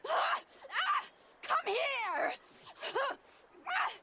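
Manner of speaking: high, shrieking threats; jealous fury. Acted. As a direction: scream at the cheating husband. Jealous wife attacks husband.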